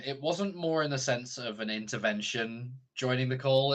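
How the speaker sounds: normal talking voice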